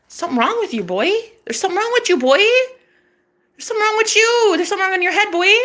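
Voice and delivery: escalating high-pitched taunt; mock scolding. Woman taunting boyfriend about his head, mock scolding.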